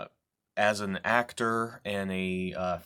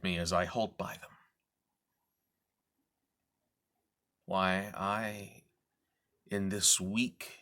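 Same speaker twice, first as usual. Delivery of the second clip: brooding recitation. Acted. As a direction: confide his bitterness to the audience, hushed. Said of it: "I'm going to do a reading".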